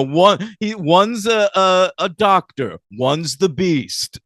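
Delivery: high, halting sketch-comedy explanation; Spike. Retold or imitated. Fan continuing Spike's explanation of Ben and Glory, high drawn-out imitation. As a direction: stretch each item out in comic rhythm. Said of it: "Spike just falls into that".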